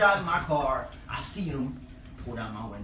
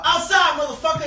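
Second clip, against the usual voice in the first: high, loud holler back; shouted reply; 12 semitones higher, 11 dB louder than usual. Retold or imitated. Horse rider answering the nosy driver, loud shout. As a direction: fire the answer back at full volume.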